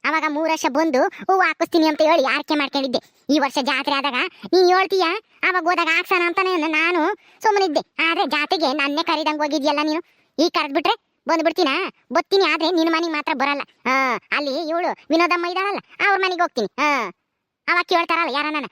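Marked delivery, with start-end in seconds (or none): none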